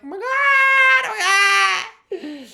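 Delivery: high, loud mock crying; hammed-up tearful squeal; overwhelmed mock wail. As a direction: wail it like an overcome fan. Her reaction as they start crying.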